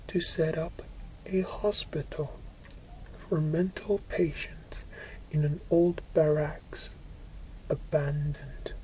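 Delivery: ordinary delivery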